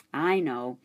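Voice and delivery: plain reading voice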